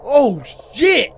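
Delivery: high, loud burst of shock; shocked exclamation. Real, not acted. Streamer hearing gunshots in a video, shocked exclamation. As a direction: exclaim in real alarm.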